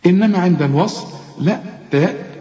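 ordinary delivery

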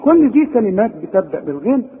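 ordinary delivery

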